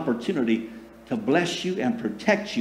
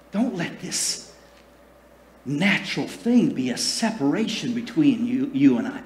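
gentle and tender. Shown as a demonstration. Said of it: "God is not saying it with a loud".

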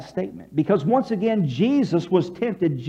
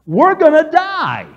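high, loud, drawn-out plea; panicked cry; 7 semitones higher, 7 dB louder, much slower than usual. Acted. Disciples waking Jesus in the storm, panicked cry. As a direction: cry out as if about to drown.

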